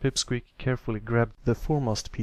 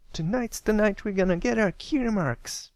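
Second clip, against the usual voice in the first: very high, louder proud declaration; proud excited filly; 11 semitones higher, 3 dB louder than usual. Acted. Scootaloo proudly declaring the night's goal, very high. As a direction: announce it with chest out. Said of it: "Scootaloo said proudly".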